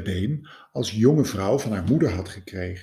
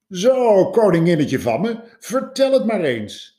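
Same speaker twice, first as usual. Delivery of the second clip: high, loud, breezy know-it-all voice; self-assured scientist addressing the queen; confident scientist; 8 semitones higher, 6 dB louder than usual. Acted. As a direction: talk down to the queen cheerily. Confident scientist takes charge.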